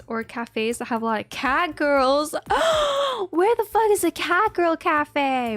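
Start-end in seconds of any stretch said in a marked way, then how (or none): 2.4-3.2 sharp high gasp of delight; excited gasp. Real, not acted. Gasping to chat about a cat girl cafe. 3.3-5.6 eager demand, pitched up; excited. Real, not acted. Excited outburst.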